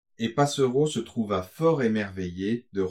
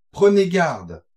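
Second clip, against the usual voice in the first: high, loud warning cry; urgent warning; 8 semitones higher, 7 dB louder, slower than usual. Acted. Coachman warning a lovestruck student, urgent cry. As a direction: burst out higher and louder to warn.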